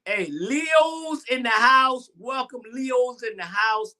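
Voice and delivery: high, drawn-out call to the crowd; celebratory shout-out. Real, not acted. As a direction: hail your people like a party host. Calling out to Leo viewers.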